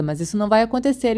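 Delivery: plain reading voice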